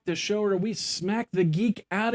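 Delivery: booming high ringmaster welcome. Acted. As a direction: roll up the crowd like a circus barker. Grand show opening announcement.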